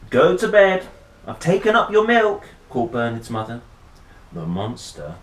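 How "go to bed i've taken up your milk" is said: higher, louder call up the stairs. A narrator's reading. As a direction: call to the child upstairs. Mother calls upstairs.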